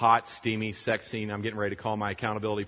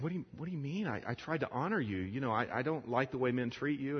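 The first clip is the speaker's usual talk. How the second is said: higher, softly pleading self-defence; bewildered protest; 5 semitones higher, 3 dB quieter than usual. Retold or imitated. Man defending himself to the crying woman, softly bewildered. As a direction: plead innocence in a small high voice.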